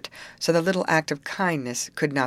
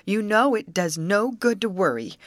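higher-pitched brisk scolding; sharp command; 6 semitones higher, 4 dB louder than usual. A narrator's reading. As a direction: scold a friend out of worrying. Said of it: "commanded the Little Captain sharply".

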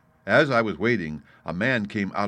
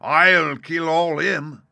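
high, loud, drawn-out vow; excited threat; 8 semitones higher, 7 dB louder, much slower than usual. A narrator's reading. Landlord threatening the runaway, worked up. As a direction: roar it slowly as a threat. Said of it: "he was jerking out these phrases".

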